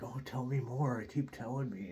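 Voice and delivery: imitating an excited hanger-on, high and loud; puppy-dog eager. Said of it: "puppy dog eyes".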